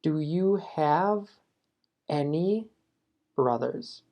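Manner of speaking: slow, careful read of the right form; slow correct model. Shown as a demonstration. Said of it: "Queremos decir". (modelo correcto, lento)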